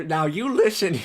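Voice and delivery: badly faked Irish accent; terrible Irish accent. Host starting to scold co-host, terrible Irish accent. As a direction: have another poor go at an Irish accent. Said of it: "do this in a terrible accent".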